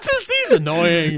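high, slow, laughing studio exec; brash movie producer. Pretend. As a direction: play a clueless Hollywood producer. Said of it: "you sound like a Hollywood producer".

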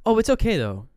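high, loud opening that drops on "though"; retold reassurance. Retelling a character's reassurance.

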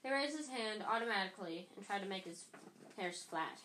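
usual speaking voice

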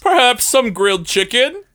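high, quavering heroic knight; hammy stage actor. Acted. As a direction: declaim it like a stage hero. Knight announces himself.

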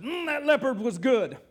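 loud, slow, smacking-lips boast; a dog bragging loudly over the bones; smug dog. Acted. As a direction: savour every word like a feast. Dog fakes eating leopard.

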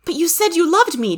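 high-pitched woman's protest; high, wounded objection; hurt cry. A narrator's reading. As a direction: stammer the opening in a high voice. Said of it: "Daisy cried".

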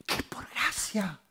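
emphatic stage whisper; hushed emphasis. Real, not acted. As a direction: whisper it like a wondrous secret. Climax dropped to an emphatic whisper.

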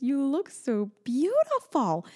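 put-on slow, high, adoring little-girl voice; toddler voice. Mother imitating her daughter praising her dress. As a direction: imitate the child in awe. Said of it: "She goes".

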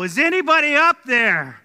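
loud, high call for help; desperate yell from far below. Retold or imitated. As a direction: shout it up the cliff like he's dangling. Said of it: "he begins to yell".

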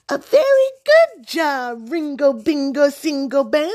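put-on piercingly high baby-talk gush; squealy voice. A narrator's reading. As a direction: squeal silly praise at a pet. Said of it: "He especially liked a squealy voice".

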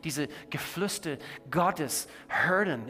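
rising, impassioned preacher's plea; fervent preaching. Acted. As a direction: preach it with full conviction.

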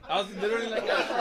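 laughing through the words; giggling while telling it. Real, not acted. Laughing while retelling reaction.

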